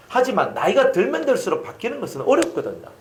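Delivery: ordinary delivery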